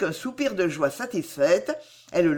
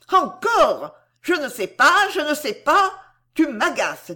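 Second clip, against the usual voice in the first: much higher, loud furious cry; angry shout; 8 semitones higher, 5 dB louder than usual. A narrator's reading. Girl stamping her feet at her admirer, furious shout. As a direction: explode with sudden rage. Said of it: "rouge de colère cette fois, elle cria".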